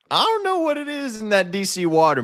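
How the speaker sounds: way up high, mock disbelief; squeaky high voice. Sudden high squeaky aside.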